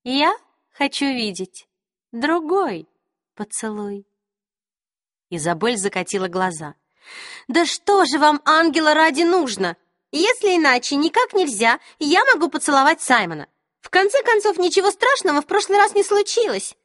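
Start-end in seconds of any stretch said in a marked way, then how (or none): 0.1-4.1 high, slow, ringing queen voice; ringing crystal voice. A narrator's reading. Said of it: "прозвенел хрустальный голос королевы". 7.5-16.8 high, loud and fed up; exasperated young woman. A narrator's reading. Exasperated Isabel.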